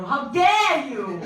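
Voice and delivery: slow, loud mock outrage; a hammed-up scolding cry; Greta Thunberg. [name] hearing a furious activist scold. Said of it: "I could hear Greta Thunberg go".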